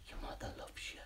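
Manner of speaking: faint bedtime whisper. Pretend. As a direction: breathe it like a lullaby. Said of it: "sent him to sleep".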